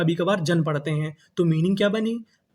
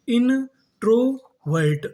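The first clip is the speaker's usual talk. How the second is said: Introvert drawn out very slowly and higher; slow syllable by syllable; 4 semitones higher, much slower than usual. Shown as a demonstration. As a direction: break the word apart slowly. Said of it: "तो पूरी meaning क्या बनी". (धीरे-धीरे शब्दांश तोड़कर)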